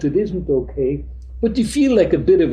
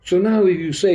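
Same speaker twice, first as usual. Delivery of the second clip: ordinary talk, nothing marked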